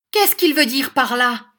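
high, loud outrage; indignant shout. A narrator's reading. Schoolmaster's wife raging about the boy, indignant shout.

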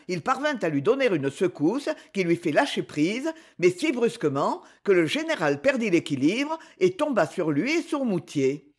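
ordinary delivery, nothing marked

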